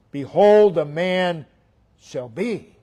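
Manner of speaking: "Behold" hit loud, then falling away; stress on Behold. Shown as a demonstration. Preacher showing where the verse's emphasis lies, stress on "Behold". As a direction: proclaim "Behold", letting "shall be" drop. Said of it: "The emphasis is".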